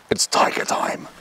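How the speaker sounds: breathy stage whisper; whispered hype. Acted. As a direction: whisper it like a secret. Hyped intro to riding section.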